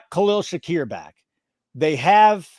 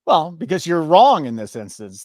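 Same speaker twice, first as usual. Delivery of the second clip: normal talking voice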